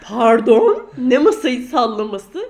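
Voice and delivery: loud, slow, outraged protest; indignant outcry. Retold or imitated. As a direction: blurt it out in disbelief. Indignant retort, much louder.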